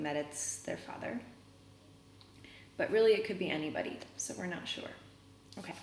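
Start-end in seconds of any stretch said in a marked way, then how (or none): none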